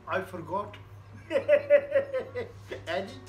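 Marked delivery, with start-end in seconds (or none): 0.8-2.8 high embarrassed chuckle; laughing. Real, not acted. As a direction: laugh it off sheepishly. Laughs after admitting he forgot.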